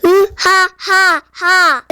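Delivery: slow, booming evil laugh; booming giant laugh. Acted. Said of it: "껄껄 웃으며 말했어요".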